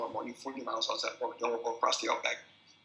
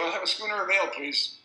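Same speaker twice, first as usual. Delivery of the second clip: raised voice ordering a drink; loud order at the bar; 6 semitones higher, 6 dB louder than usual. Retold or imitated. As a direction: call out the order over the noise. Orders ale in rough pub.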